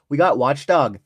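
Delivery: hammed-up Canadian accent, lively; playful put-on Canadian accent. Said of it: "Let's do this one Canadian style".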